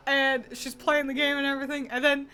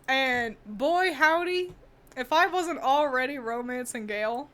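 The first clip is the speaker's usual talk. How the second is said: usual speaking voice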